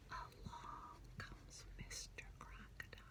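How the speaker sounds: whispered, sneaking; suspense whisper. Acted. Teacher reading to children as the crocodile creeps up, whispered.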